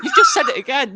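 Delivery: high, helpless laughing accusation. Real, not acted. Host catching co-host repeating the slip, laughing.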